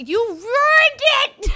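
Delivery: angry outburst. Acted. Acted outburst from [name].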